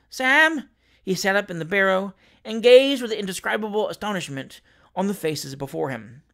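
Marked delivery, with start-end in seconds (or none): 0.1-0.7 higher, louder call; cry out. A narrator's reading. Pickwick waking and crying for Sam. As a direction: call out for his servant. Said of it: "indistinct cries of".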